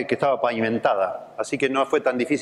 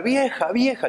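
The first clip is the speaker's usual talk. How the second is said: high call to his wife; 10 semitones higher, slower than usual. Retold or imitated. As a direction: call out to the wife across the house. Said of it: "empieza a gritar".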